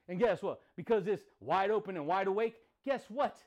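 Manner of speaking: normal talking voice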